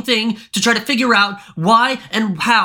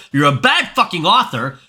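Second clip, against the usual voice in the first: normal talking voice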